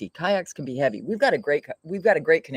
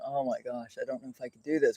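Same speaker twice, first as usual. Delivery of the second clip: retold weary doubt; 2 semitones lower, 8 dB quieter than usual. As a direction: imitate yourself worn out and doubting. Retelling exhausted self on the porch.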